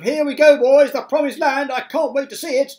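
very high, loud whoop; excited shouts. A narrator's reading. Company about to climb to the promised land, excited shouting. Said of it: "mounting excitement and shouts of".

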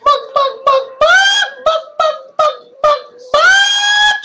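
high shouted clucks like a playful hen; mock chicken clucking. Dad clucking like a hen while feeding baby chicken, high and shouted.

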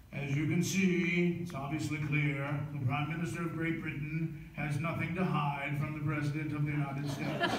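a hint of Churchill, deadpan; Churchill accent. Churchill answering FDR dripping wet, lightly accented. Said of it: "And Churchill says".

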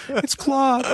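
very high, cracking self-introduction. Retold or imitated. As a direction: squeak it out in mock nerves. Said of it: "my voice is cracking".

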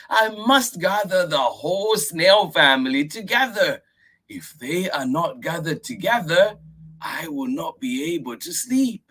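put-on high earnest child voice; little girl voice. Miyuki insisting to grandfather.

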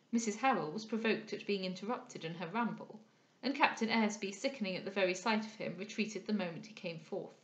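measured, projected audiobook reading; story narration. A narrator's reading. Reader telling listeners of Cecilia and her company at the theatre, story narration. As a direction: read the novel out with crisp expression. Reads the novel aloud.

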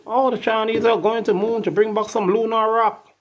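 lightly mocking impression; mocking the moon mission in a high voice. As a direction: say it up high like a taunt. Mocking claim about Chinese.

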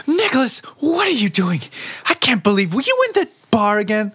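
high, sharp scolding of a late husband; wife catching her husband back from the bar. Acted. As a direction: tell him off like a furious wife. Wife scolds husband come home.

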